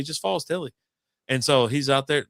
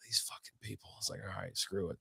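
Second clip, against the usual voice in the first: very soft, low grumble; exasperated muttering; 5 semitones lower, 17 dB quieter than usual. Retold or imitated. Finder giving up at the unanswered door, muttering. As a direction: mutter it under your breath, giving up.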